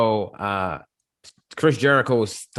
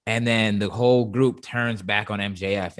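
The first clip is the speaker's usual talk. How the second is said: ordinary talk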